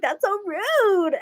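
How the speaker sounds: high, drawn-out indignant blurt. Retold or imitated. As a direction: stretch out RUDE in mock offence. Acting out her shocked remark.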